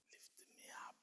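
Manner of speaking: faint, breathless whisper; weak whisper. Retold or imitated. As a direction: ask with almost no voice. Sick brother asking weakly.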